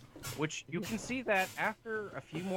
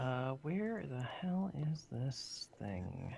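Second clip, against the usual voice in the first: whispered mutter; 4 dB quieter, slower than usual. Real, not acted. Searching for something, to self.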